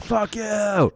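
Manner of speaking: hammed-up, high-pitched angry yell; passionate outburst. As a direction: blow up in mock passion. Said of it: "get so passionate about them".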